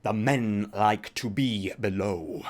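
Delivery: slow, mock-solemn storyteller voice; Matthew Colville impression. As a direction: imitate a YouTuber narrating dwarves, drawn out slow. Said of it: "He does his D and Ds like this".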